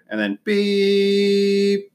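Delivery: slow high beeeep; drawn-out beep. Shown as a demonstration. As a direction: stretch the beep far too long. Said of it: "cock shredder".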